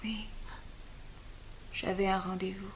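hushed, unvoiced admission; whispered confession. Antigone whispers her secret to the nurse. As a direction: breathe it out like a secret.

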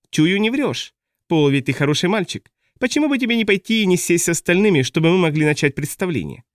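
manic high clown voice; asylum patient. A narrator's reading. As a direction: coax like an unhinged clown. Boza clown voice.

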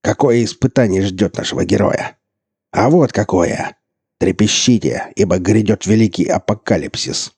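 loud hammy doom announcer; sneering panto villain. A narrator's reading. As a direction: ham up the apocalypse. Burland mocking as villain announcer.

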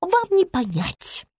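high, slow put-down; superior, high-pitched scorn; haughty dismissal. A narrator's reading. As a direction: dismiss them with drawn-out disdain. Haughty woman dismisses the narrator.

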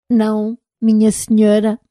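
an unsure robot answering politely; timid robot voice. Acted. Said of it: "numa voz baixa e insegura". (voz de robô tímido e inseguro)

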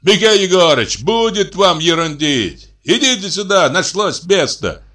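calling over. A narrator's reading. Men in another carriage summoning him, calling over. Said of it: "крикнули с другого тарантаса".

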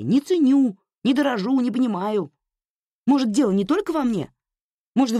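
bursting out after holding it in; shouting. Acted. Anya finally yells at her mother, losing control. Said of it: "закричала Аня, не сдержавшись".

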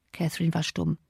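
ordinary delivery, nothing marked

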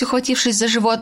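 plain reading voice

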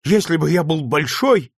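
put-on a boy choking on sobs of fury at the injustice; indignant sobbing boy. A narrator's reading. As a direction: cry out against it, voice cracking with held-back sobs. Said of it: "рыдая и громко выражая своё негодование".

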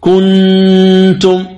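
كنتم with the ن pressed and sounded clearly; wrong clear n. Shown as a demonstration. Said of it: "والخطأ أن تقول".

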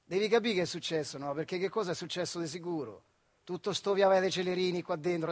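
ordinary delivery

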